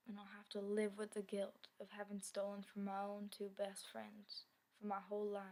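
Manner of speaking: ordinary talk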